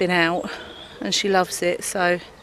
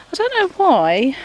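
usual speaking voice

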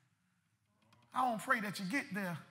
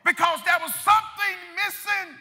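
impassioned preacher's cry; preaching at full force; 7 semitones higher, 8 dB louder than usual. Real, not acted. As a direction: drive each phrase out to the congregation.

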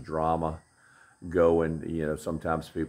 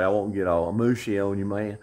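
ordinary delivery

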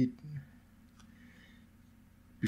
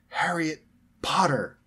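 excited; 7 semitones higher, 4 dB louder, slower than usual. A narrator's reading. George revealing name.

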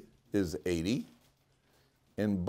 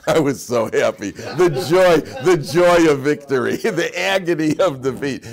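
laughing with joy; 6 semitones higher, 11 dB louder than usual. Real, not acted. Laughing at his victory story.

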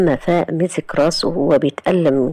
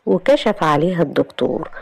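plain reading voice

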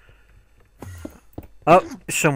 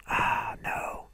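whispered ah no; 7 dB quieter than usual. Real, not acted. Reacts to a mistake.

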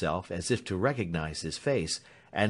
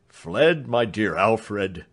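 put-on louder, slow, grief-stricken old doctor; broken voice; 5 dB louder, much slower than usual. A narrator's reading. The doctor breaks the news to Alfred, broken. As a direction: speak through grief. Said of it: "in a broken voice".

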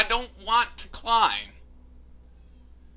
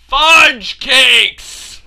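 exasperated shout; 15 dB louder than usual. Real, not acted. Player cursing at the game, frustrated shout. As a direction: shout a loud, frustrated mock curse.

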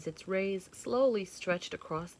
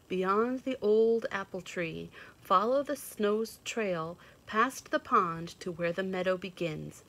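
usual speaking voice

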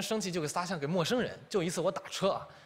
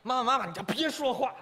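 put-on cheeky kid; 8 semitones higher, 4 dB louder than usual. 孩子学妈妈的话.

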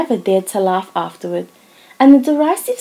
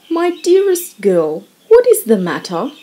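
husband bursting in, alarmed at the mess; John crying out. A narrator's reading. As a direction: rush in worried for her. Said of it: "cried John".

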